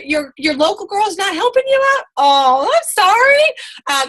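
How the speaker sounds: high, slow, sarcastic sorry; mock sympathy. Mentor teasing a recruit who chose another team, mock pity. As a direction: stretch out a fake pity, pitched high. Mocking the friend who left.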